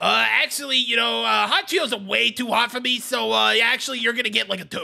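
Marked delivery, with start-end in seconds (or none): none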